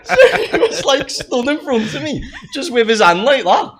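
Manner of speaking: high, squeaky talk through laughter; helpless laughter lifting the voice high; real laughing speech. Real, not acted. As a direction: barely get it out for laughing. Laughing at the absurd stabbing threat.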